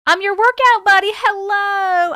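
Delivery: high, loud peppy read; cheery read. Real, not acted. Streamer reads a viewer's message, peppy and loud. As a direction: pump up the chat message.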